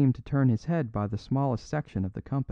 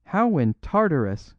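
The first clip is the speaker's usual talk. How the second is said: higher, louder exclamation; astonished cry; 5 semitones higher, 5 dB louder than usual. A narrator's reading. Robber crying out to the priest, astonished. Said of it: "cried Flambeau".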